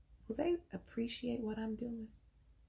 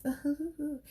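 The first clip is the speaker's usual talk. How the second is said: higher, cooing gush; gushing church ladies; 5 semitones higher than usual. Retold or imitated. Church women gushing to Dolores about her husband. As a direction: coo over her as if swooning. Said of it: "And the women be like".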